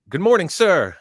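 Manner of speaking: loud, high military salutation; recruit's shouted greeting. Retold or imitated. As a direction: sound off to the drill instructor. Recruit greeting the drill instructor.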